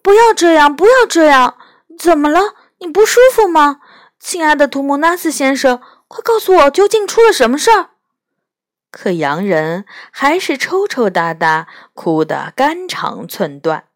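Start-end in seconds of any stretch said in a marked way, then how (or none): 0.0-7.9 high, louder, rushing pleas; pleading urgently. A narrator's reading. Lucy pleading with the crying faun, high and urgent. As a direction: beg him to stop and tell her.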